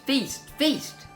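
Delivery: usual speaking voice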